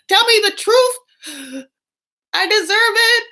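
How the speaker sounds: high, loud, drawn-out mock shriek; very upset. Wife demanding answers from Michael, hammed-up upset shriek. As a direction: wail the demand in hammed-up outrage. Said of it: "zero to sixty in the way she talks".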